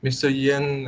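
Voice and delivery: usual speaking voice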